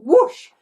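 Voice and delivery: high loud rising whoosh; whoosh sound. Acted. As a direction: whoosh up into the air. Sound effect of flight.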